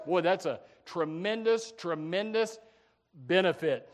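normal talking voice

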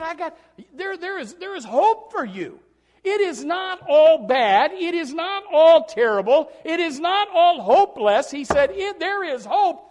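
loud, high, impassioned preaching; fiery preaching. Acted. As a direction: preach it loud to lift the congregation. Preaching Jesus's words.

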